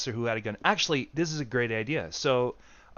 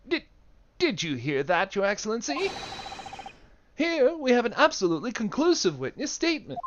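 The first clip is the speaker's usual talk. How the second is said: raised, showy courtroom address; pompous prosecutor to the judge, pitched up; a hint of a pompous lawyer; 9 semitones higher than usual. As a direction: play it up to the judge with lofty pomp.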